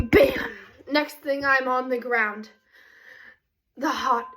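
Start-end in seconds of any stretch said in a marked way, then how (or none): 0.1-0.5 high, loud "Bam!"; sudden impact exclamation. Retold or imitated. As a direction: punch it out as a sudden story sound effect. Sudden impact in her told story.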